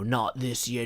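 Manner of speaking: not this year in a put-on New Zealand accent; New Zealand accent, lightly done; mock New Zealand accent. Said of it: "my best New Zealand accent".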